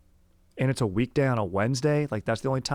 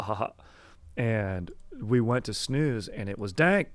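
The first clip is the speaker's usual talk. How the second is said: ordinary talk, nothing marked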